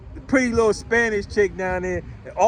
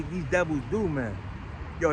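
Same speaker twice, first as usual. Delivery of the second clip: ordinary talk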